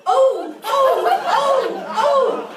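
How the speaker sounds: loud, high exaggerated "oh"s; hammed-up moans, building in urgency. Comedian demonstrates guiding a partner closer, loud mock moans. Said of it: "and get louder".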